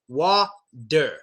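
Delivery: "Water" broken into "wah... der"; D sound. Shown as a demonstration. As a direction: split "Water" slowly, D on the second syllable. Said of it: "Use a D sound".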